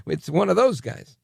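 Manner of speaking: mock dismay. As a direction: retell his own eye-rolling reaction. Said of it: "And I said".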